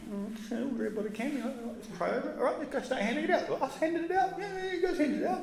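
soft, high, quick mock back-and-forth; lightly voiced sheepish exchange; hushed disciples. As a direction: play nervous disciples whispering to Jesus. Disciples and Jesus acted, hushed.

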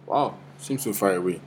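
ordinary talk, nothing marked

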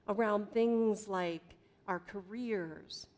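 usual speaking voice

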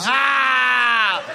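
sudden high yelp of alarm; panicked yelp. Pretend. Comedian acting out his own panic, high yelp. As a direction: yelp it out, mock terrified.

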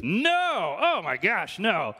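emphatic, drawn-out acted no; mock-horrified denial. Wedding guest denying he is a seminary student, mock-horrified. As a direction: deny it as if appalled by the idea.

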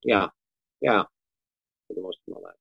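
usual speaking voice

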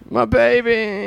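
high, slow drawn-out mock wail; wailing lady. Joining in quoting Sylvia, high slow wail. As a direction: stretch it out, mock-distraught.

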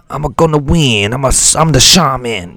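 loud, high Wario-style cackling boast. Acted. Shaman boasting he'll win in a loud Wario voice. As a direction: ham it up like a cartoon villain. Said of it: "I sound like a Wario".

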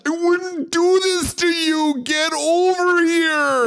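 high, loud, slow desperate shout; pleading villain yell. Pretend. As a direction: beg and roar at once. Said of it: "Scorpion catchphrase".

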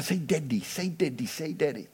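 put-on soft, hushed coaxing to a baby; dad coaxing his baby at photo time, soft and hushed; coaxing softly. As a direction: softly coax the baby to say daddy. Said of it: "they work really hard at every photo".

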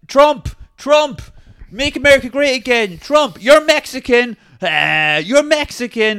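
loud, high crude chant; mock obnoxious hollering; imitated street heckling. Streamer heckling passers-by on busy street, mock hollering. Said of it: "he keeps going like".

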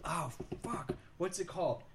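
ordinary talk

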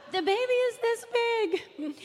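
high, squeezed exclamation of mock amazement; amused disbelief. Storyteller showing a tiny baby beside a huge bottle, high amused exclamation. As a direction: pitch way up, marvelling at the absurdity. Amused at absurd size in dream.

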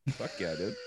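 low, soft California bro; California bro accent. Shown as a demonstration. Imitating how the guys say dude, low California bro accent. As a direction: toss it off in Cali bro speak.

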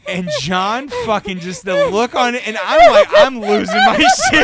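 real high, loud helpless laughter through the words; losing it laughing. Real, not acted. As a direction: crack up mid-story, squealing out the words. Cracks up telling the funny story.

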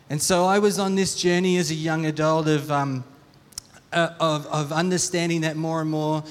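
usual speaking voice

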